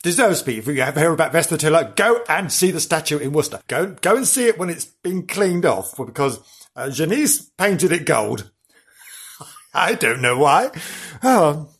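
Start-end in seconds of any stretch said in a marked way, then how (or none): none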